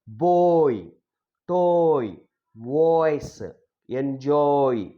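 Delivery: put-on "boy", "toy", "voice", "enjoy" each with a long oi; slow model pronunciation. Shown as a demonstration. Teacher drilling a diphthong, each oi word said slowly. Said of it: "slow like that".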